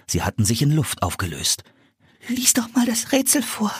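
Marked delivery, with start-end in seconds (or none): 2.3-3.7 put-on high girl's voice, teeth chattering; a young girl shivering with cold. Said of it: "bat Anne mit klappernden Zähnen".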